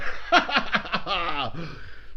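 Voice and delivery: hearty laugh at own joke. Real, not acted. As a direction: cackle at your own cheeky joke. Joking about Keane's challenge.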